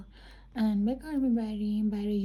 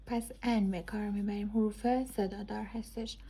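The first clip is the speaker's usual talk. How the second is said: ordinary talk, nothing marked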